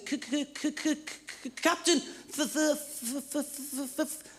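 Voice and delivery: high, stuttering on every first sound; stammering lookout. Acted. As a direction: stutter out the warning, stuck on each word. Lookout stammers warning to captain.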